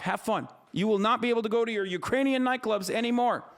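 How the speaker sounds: mocking, fired-up rant at a chatter; heated taunting. Real, not acted.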